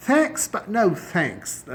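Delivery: drawn-out sarcastic thanks; dismissive slow refusal. Shown as a demonstration. Teacher demoing an informal brush-off, slow and mocking. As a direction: wave it away slowly. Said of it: "informal سا style ہے".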